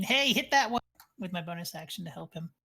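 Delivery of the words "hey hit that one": raised, louder call to an ally; half-hearted in-character shout; mock yell. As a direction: call out an order, lightly acted. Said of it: "I'm gonna yell to Union".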